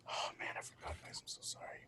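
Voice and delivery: a quick whispered sorry under his breath; whispered aside apology. Real, not acted. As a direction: whisper it off-mic to the guys.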